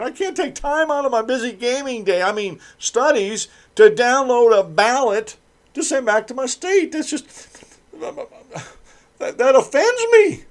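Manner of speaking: high whiny falsetto mocking a lazy student. Radio host mocks college student in high whiny falsetto. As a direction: sneer at him in a high squeaky whine.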